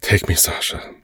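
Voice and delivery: urgent whispered plea; breathy whisper. Acted. Lover pleading in the moment.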